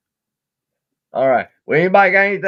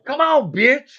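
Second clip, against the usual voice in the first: mock frustrated snap; 9 semitones higher, 4 dB louder, slower than usual. Yelling at frozen computer.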